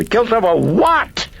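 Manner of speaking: high, loud hysterical outburst; hysterical cry. A narrator's reading. Chris cries out at the priest in sudden hysteria, high and loud. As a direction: scream it at him, losing control.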